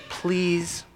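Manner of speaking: soft, drawn-out plea; gentle begging please; pleading. Shown as a demonstration. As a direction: stretch the word out softly, imploring. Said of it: "like that".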